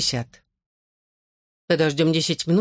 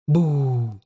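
low, sudden stone creature boo; spring a boo from the dark; gargoyle voice; 4 semitones lower than usual. A narrator's reading. Gargoyle startles them.

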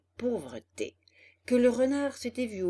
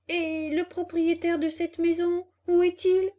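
high, loud puzzled question; acted puppet's amazed query; astonished; 9 semitones higher, 7 dB louder than usual. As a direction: call out in surprise to an empty house. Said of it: "demanda Pinocchio, étonné".

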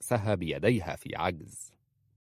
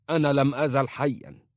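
put-on raised, higher voice of a proud old father; father rebuffs the uncle, raised and indignant; indignant retort; 6 semitones higher, 5 dB louder than usual. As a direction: snap back that he still lives.

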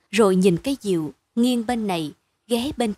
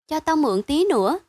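put-on higher-pitched child's wheedle; mild pleading in a raised voice; 6 semitones higher than usual. A narrator's reading. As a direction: beg for a little longer, pitch lifted. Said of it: "Mai năn nỉ".

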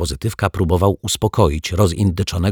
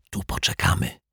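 soft, secretive whisper; 7 dB quieter than usual. A narrator's reading. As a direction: whisper it covertly. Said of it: "szepnęła Zuzia". (cichy, konspiracyjny szept)

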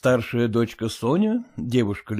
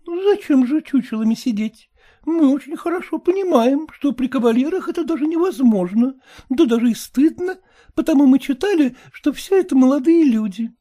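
put-on high, cheeky girl's voice; high mocking daughter; pert young daughter; 4 dB louder than usual. A narrator's reading. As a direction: tease the mother lightly.

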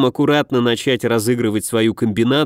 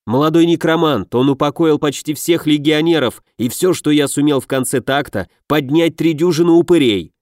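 ordinary delivery, nothing marked

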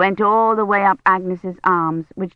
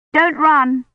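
higher, louder warning call; 8 semitones higher, 4 dB louder than usual. Acted. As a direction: warn the running child. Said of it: "Kivrin started to say".

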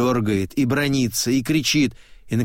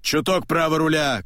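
shout; 5 semitones higher than usual. A narrator's reading. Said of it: "кричит он".

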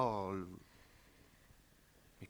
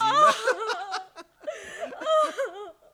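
high, loud helpless laughter; loud laughing burst; 9 dB louder than usual. Acted. Husband bursting with laughter at his own joke. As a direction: burst out at the punchline.

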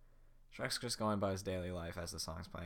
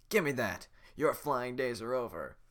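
gruff guard; 3 semitones higher, 8 dB louder than usual. Acted. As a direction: taunt the witch. Guard takes witch's broom.

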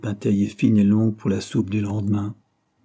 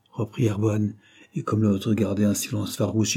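ordinary delivery, nothing marked

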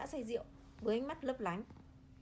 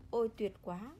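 ordinary delivery

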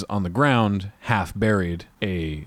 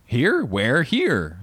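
startled questions; 5 semitones higher, much slower than usual. Retold or imitated. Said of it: "And the ghost, whoever, says".

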